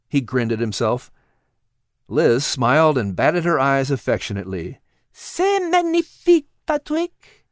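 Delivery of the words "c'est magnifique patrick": put-on high, lilting French-accented cat voice; a wise cat coaxing gently; cat Liz. Cat Liz coaxing Patrick in a high French-tinged voice.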